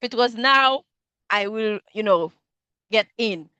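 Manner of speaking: ordinary delivery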